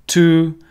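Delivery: loud, high two with a sharp aspirated t; crisp T. Shown as a demonstration. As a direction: snap the t of two for the class. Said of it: "problem with the sound T".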